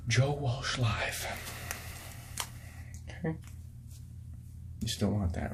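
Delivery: usual speaking voice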